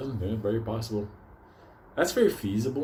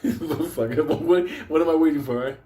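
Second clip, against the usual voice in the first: high and loud through a laugh; 6 semitones higher, 6 dB louder than usual. Real, not acted. Telling viewers a story, loud laughing outburst. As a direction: burst out laughing and push on.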